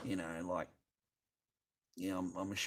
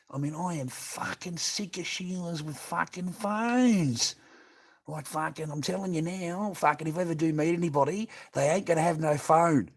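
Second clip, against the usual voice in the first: higher and louder, building into a fed-up rant; angry rant; 7 semitones higher, 6 dB louder than usual. Real, not acted. Ranting to the boys about women on phones, heated and rising. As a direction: vent it, getting worked up.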